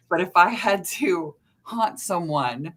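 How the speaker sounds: usual speaking voice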